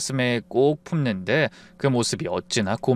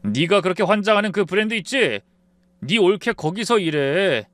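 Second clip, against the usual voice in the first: mild mock yell; 6 semitones higher, 3 dB louder than usual. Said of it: "버럭 소리를 질렀습니다".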